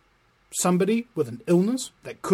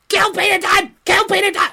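high, loud shouted tic; mock outburst; 14 semitones higher, 7 dB louder than usual. Joking Tourette patient blurting at the minister, shouted.

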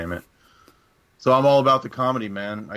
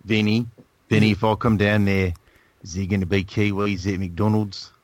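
joking Australian-Kiwi twang, lightly done; mock Australian accent, teasing the host. Guest teasing the host about Kiwis, mock Australian accent. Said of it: "that's an Australian accent".

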